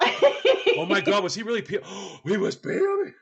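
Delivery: loud, high gasping disbelief; hammed-up scandalized outburst; mock shocked outrage. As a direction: imitate a lady aghast, gasping mid-question. Shocked ladies realize it's a guy.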